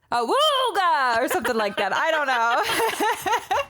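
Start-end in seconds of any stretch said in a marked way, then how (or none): 0.1-1.9 high laughing awooga; ahooga horn. Acted. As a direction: honk it through the giggles. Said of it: "I can't even do it".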